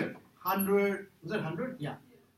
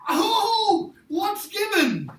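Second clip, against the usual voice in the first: mock surprise; 11 semitones higher, 6 dB louder, much slower than usual. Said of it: "teacher prompting the class".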